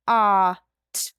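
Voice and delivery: "Ah" then "t" as separate sounds; sounding out phonemes. Shown as a demonstration. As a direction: say each sound of "Pot" alone. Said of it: "How many sounds in the second syllable?".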